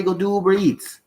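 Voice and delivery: ordinary talk, nothing marked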